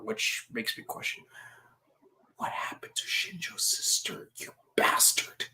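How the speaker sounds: unvoiced accusing whisper. Real, not acted. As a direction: hiss it as a side jab. Whispered aside accusing character.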